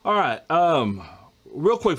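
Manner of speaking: ordinary delivery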